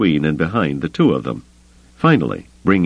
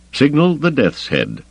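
higher-pitched, restrained command; crisp order; 10 semitones higher than usual. A narrator's reading. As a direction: give the order without shouting. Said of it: "Thrawn ordered".

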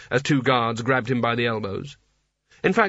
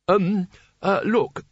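nervous Rincewind; 7 semitones higher, much slower than usual. A narrator's reading.

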